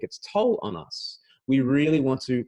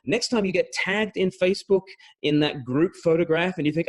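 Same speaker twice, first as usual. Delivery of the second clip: ordinary talk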